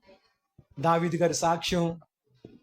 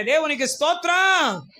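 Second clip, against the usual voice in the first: slow, high, loud cry of praise; full-voiced praise shout; 9 semitones higher, 5 dB louder, slower than usual. Real, not acted. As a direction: shout it to the rafters.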